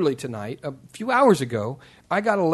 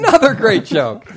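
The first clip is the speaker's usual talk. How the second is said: raised, higher voice through laughter; loud exclaiming; 7 semitones higher, 10 dB louder than usual. Real, not acted. Host repeating praise for a guest's joke, loud exclaiming. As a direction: call it out loudly, amused.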